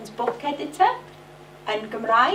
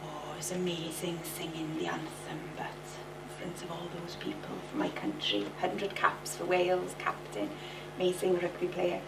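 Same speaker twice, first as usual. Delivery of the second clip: whispered, low reverent praise; hushed awe; 7 semitones lower, 11 dB quieter than usual. Retold or imitated. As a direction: breathe it in awe of the moment. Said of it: "Alfie saying".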